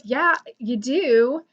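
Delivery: slow, drawn-out imitated contradiction; retold insistence. Fan arguing back at the YouTuber, slowly insistent. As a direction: stretch it out to insist, mimicking him. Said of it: "we went back and forth".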